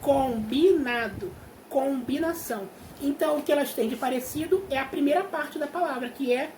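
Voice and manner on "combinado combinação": slow, stretched syllables in each word; teacher spelling out word parts by ear; drawn-out demonstration. Shown as a demonstration. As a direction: sound out "Combinar, combinado, combinação" slowly for a class. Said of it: "Pensa aí, ó".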